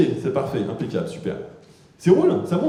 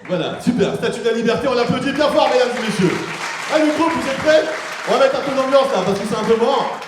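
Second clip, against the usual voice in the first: loud, high showman hype; louder, higher emcee voice; hyping the crowd; 6 semitones higher, 4 dB louder than usual. Acted. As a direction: whip the crowd up like a host.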